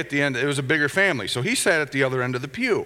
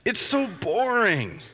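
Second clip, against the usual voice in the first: put-on higher, slow whining kid voice; whiny little boy; 6 semitones higher, slower than usual. Bored boy answers his sister in a whiny voice. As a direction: drag it out like a bored, fidgety boy. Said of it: "And he's like".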